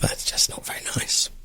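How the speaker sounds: whispered confession; whispering. Real, not acted. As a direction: whisper it like a secret. Confiding the coffee is bad.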